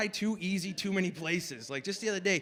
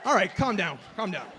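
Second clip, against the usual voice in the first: hushing the crowd; 4 dB louder than usual. Real, not acted. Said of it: "comic hushing the crowd".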